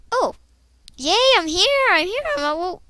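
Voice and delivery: delighted "yay, I'm here"; excited. Real, not acted. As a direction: celebrate arriving. Excited arrival in game.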